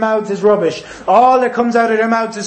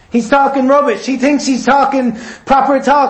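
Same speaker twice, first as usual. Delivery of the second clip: normal talking voice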